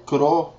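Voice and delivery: Cro- with a halfway rolled R; teacher demonstrating the tap. Shown as a demonstration. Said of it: "halfway rolling your R".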